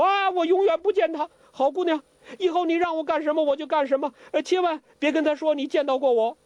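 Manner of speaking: high, fast, frantic begging; panicked pleading old imp. Acted. Zhou Botong begs Huang Rong not to tell, panicked.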